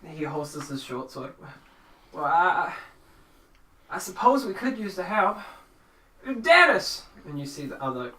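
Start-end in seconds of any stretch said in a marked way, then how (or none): 2.1-7.0 high, loud, hesitant farmer; nervous country character voice, pitched up; flustered farmer. Acted. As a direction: stammer reluctantly, then call out the name. Farmer gives in, calls Dennis.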